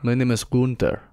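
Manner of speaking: put-on German accent introducing himself as Gunther; mock German Gunther. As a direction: lightly voice the wrestler's German self-introduction. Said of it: "according to him, his name's Gunther".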